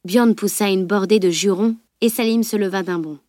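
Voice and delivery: ordinary delivery, nothing marked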